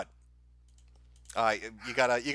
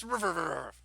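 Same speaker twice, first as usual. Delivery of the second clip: mock dog barks; 4 dB quieter than usual. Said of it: "I'm just gonna bark".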